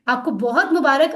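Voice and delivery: ordinary delivery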